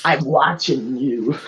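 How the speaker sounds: slow, drawn-out mock menace; mock suspicion. Game master echoing the watching bit, mock menace. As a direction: echo it slowly like a warning.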